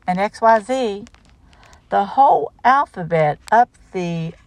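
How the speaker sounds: put-on lively singsong read-aloud for kids; reading to children. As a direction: bounce through the rhyme for little listeners. Reads picture book aloud.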